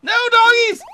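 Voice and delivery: high loud shriek; alarmed shriek. Pretend. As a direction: shriek it as the dogs come. Dogs sniff him out.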